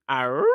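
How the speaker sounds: drawn-out "arr?" sliding up; puzzled noise. Retold or imitated. Host doubting the rent-free claim, puzzled noise. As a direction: make a short doubting, questioning noise. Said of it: "I said".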